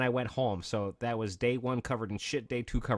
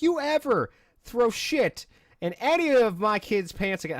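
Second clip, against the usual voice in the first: much higher, louder and slow, a retold furious dad; mock dad threat; 11 semitones higher, 5 dB louder than usual. Friend's dad grabbing the bully by the collar, mock furious. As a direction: threaten the bully like an enraged father.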